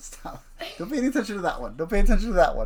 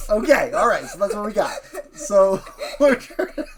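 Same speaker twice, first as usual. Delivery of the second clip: talking through real laughter; words bubbling out between laughs; laughing; 6 semitones higher, 5 dB louder than usual. Real, not acted. Dad cracks up at answer.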